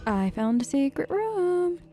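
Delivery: usual speaking voice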